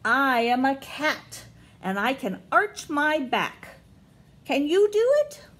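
big storybook gorilla line; reading to children. Acted. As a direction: read boldly to little ones, lift the question. Reading picture book to kids.

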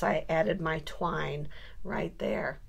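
slow whispered aside; soft whispered craft aside. Real, not acted. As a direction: whisper it while showing the detail. Quiet aside while crafting.

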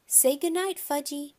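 put-on high singsong to a toddler; coaxing mother. A narrator's reading. Mother coaxes toddler to bed.

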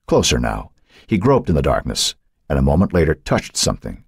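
plain reading voice

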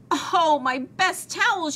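high, drawn-out lament; slow, high-pitched dismay; dismayed wail. A narrator's reading. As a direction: wail slowly over a ruined treasure. Owner finds her towels ruined.

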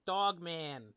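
loud superhero name reveal; superhero announcer. Pretend. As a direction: proclaim it like a hero.

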